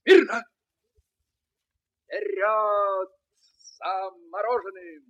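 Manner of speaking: high, loud parade-ground orders; shouted drill commands. Acted. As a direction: call the march like a drill sergeant.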